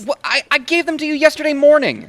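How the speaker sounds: high, loud and rushed, stammering in disbelief; flustered protest. Acted. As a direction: blurt it out fast, caught off guard and indignant. Boss accuses him of not copying.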